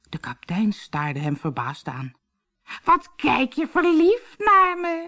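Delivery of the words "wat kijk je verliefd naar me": slow, high mock-bride voice; squeaky disguise voice. Harlekijn in disguise flirts with captain, squeaky voice. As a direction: squeak it coyly as a fake bride. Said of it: "Ik piep zo".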